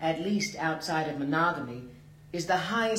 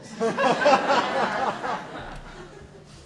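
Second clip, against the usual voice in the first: laugh; 7 semitones higher, 3 dB louder than usual. Real, not acted. Narrator laughs after the joke.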